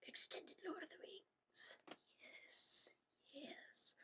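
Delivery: eager hushed whisper. Real, not acted. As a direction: whisper it with glee. Whispered excited reply.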